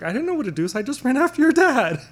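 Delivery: rising high and loud; pitching up into her voice; high mom voice. Retold or imitated. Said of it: "So I was like".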